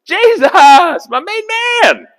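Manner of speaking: high, loud whoop of welcome; overjoyed greeting. Acted. Herod greatly rejoicing at seeing Jesus, hammed-up joy. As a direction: holler it like greeting a favorite buddy. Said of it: "he greatly rejoiced".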